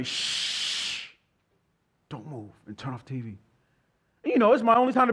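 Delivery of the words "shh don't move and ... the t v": softer, hissed hush from mom. Retold or imitated. Mother hushing her son at the TV, urgent hush. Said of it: "yell at me".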